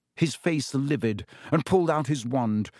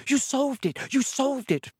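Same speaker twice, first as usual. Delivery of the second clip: very high excited cry; screaming with joy; 12 semitones higher than usual. A narrator's reading. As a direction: run to him shrieking.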